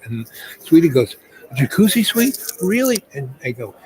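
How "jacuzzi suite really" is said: delighted. Retold or imitated. Girlfriend delighted at the offer.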